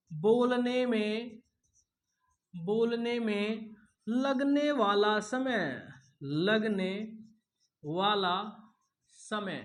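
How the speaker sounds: slow, word-by-word dictation; dictation pace. Shown as a demonstration. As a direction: dictate slowly for notes. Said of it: "लिखो ध्यान से". (धीरे-धीरे लिखवाते हुए)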